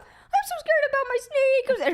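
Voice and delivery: squeaky animated sloth whine; Sid the Sloth voice. Shown as a demonstration. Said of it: "do you guys want the Sid the Sloth voice".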